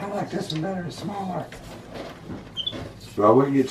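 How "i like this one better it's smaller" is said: small, high, soft pet voice; high-pitched, hushed critter voice; voicing the monkey. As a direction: speak for a little monkey, high and quiet. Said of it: "He's like".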